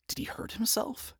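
soft worried whisper. Acted. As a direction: breathe it out, worried. Charlie's worried inner thought.

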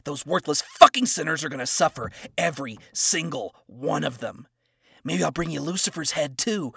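a vengeful angel bellowing his threats; furious threat. Acted. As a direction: roar your threats at her. Adam threatens Lilith.

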